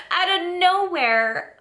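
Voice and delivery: said high through laughter; laughing. Real, not acted. Vlogger amused at her own words, laughing high. Repeats phrase, second time laughing.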